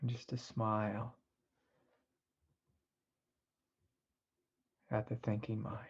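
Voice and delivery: soft, unhurried meditation voice with long pauses; guided meditation. Acted. Teacher guiding listeners away from the thinking mind, soft guided meditation. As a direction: ease them gently into stillness, softly.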